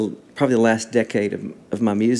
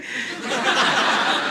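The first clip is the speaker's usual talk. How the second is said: laugh; 12 semitones higher than usual. Real, not acted. Laughing at the moment.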